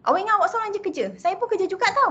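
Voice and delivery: wife answering her angry husband, indignant and high; mock retort. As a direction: throw it back at the husband, offended. Acting a wife snapping back.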